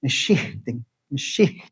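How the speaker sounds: softly said "Meshichting" with an airy H after "shi"; quiet demonstration of the aspirated H in "Meshichting"; little aspiration. Shown as a demonstration. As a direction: let a puff of air close the "shich" in "Meshichting". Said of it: "the H is like a little aspiration".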